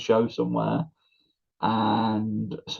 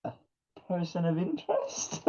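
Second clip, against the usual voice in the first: mock disbelief; 8 semitones higher, 11 dB quieter than usual. Tom's disbelief at the label.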